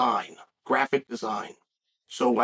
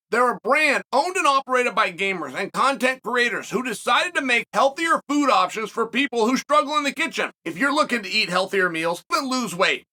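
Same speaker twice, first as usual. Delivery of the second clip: ad read; 5 semitones higher than usual. Acted. Reads sponsor ad.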